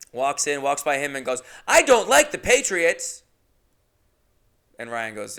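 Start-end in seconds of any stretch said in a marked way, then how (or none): 1.7-3.2 put-on high, loud, slow whiny little boy; bratty kid voice. A kid loudly telling a stranger he hates his team. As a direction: play the annoying kid who hates the Patriots.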